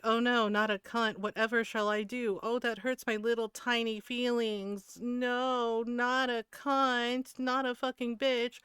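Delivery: slow, drawn-out fake woe; sarcastic mock distress. Streamer mocking the man's insults, slow sarcastic lament. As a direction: feign wounded feelings, dragging each phrase.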